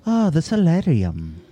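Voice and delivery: slow, drawn-out put-on posh accent. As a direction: say it like a stuffy aristocrat. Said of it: "That was a great accent you did".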